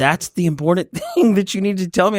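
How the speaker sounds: laughing in disbelief; incredulous laugh. Retold or imitated. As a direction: laugh at how small it is. Dad incredulous at son.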